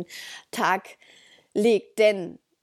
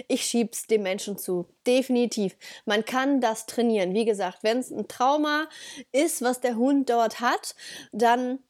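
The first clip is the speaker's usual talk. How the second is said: ordinary talk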